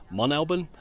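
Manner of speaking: "Mon Alban" with no T, climbing high at the end; questioning alternative pronunciation. Shown as a demonstration. Vlogger unsure of the site's name, questioning last attempt. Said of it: "heard this pronounced so many ways".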